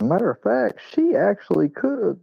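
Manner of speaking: high-pitched sassy correction; mock indignant retort. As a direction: fire it back with sass. Retold own retort.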